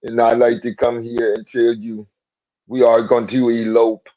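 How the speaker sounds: low, heavy mock Russian accent; lightly put-on Russian accent. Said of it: "that's your Russian accent".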